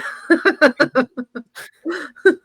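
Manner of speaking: long, loud high laugh; real extended belly laugh; laughter. Real, not acted. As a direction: burst out laughing at your own story. Laughs after her own punchline.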